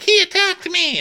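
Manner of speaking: put-on boy tattling, high-pitched whine; whiny kid. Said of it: "And Aemond's like".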